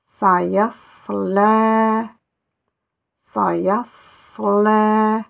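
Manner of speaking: Sa, yes, Le called out loud and clear; loud syllable drill. Shown as a demonstration. Teacher drills the syllables for students, loud and clear. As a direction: sound out each syllable firmly. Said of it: "on prononcera Le".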